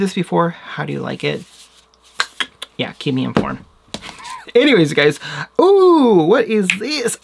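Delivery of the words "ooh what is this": high, loud delighted Ooh; excited squeal. Real, not acted. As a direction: gasp over a new find. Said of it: "Sorry, I got excited".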